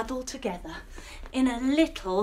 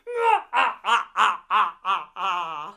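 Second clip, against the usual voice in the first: gloating cartoon villain cackle; evil villain laugh; 5 semitones lower, 4 dB louder than usual. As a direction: laugh like a smug baddie. Evil alien mudfish gloats.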